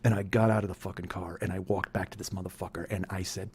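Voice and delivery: ordinary delivery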